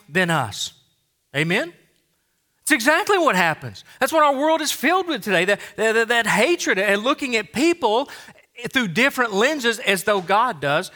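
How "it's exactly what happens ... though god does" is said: preaching at full force. Real, not acted. Preaching climax against hatred.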